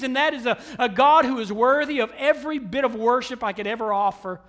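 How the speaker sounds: high, loud, sustained pulpit proclamation; full-force sermon voice; impassioned preaching. Real, not acted. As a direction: build it out boldly to the whole church.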